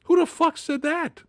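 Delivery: high, baffled what-the-hell; half-mock stunned cry from the cinema seat; incredulous outburst. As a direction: pitch it way up in disbelief. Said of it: "I was in the theater like".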